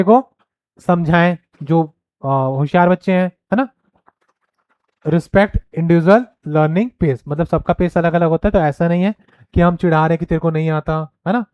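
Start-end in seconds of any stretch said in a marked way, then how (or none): 5.0-7.2 very slow, each word spaced out; dictating a point; slow dictation. Shown as a demonstration. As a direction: read it slowly so students can write. Reading slide point aloud.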